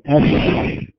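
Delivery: أش as a long, breathy sh hiss; isolated spreading sh. Shown as a demonstration. Teacher isolating the spreading sh sound. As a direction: hold the sh of أش and let it spread. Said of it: "هذا الصوت الذي نسمعه للشين".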